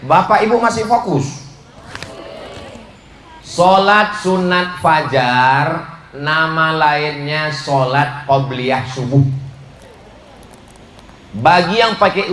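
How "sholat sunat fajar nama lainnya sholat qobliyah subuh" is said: slow teaching. Shown as a demonstration. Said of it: "Dengar baik-baik".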